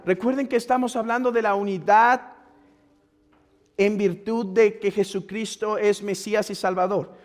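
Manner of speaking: normal talking voice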